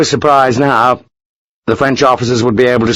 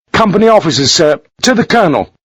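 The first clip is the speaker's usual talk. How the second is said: calling out; 7 semitones higher, faster than usual. Acted. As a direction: bark it up the grove to the officers. Sergeant calling officers together.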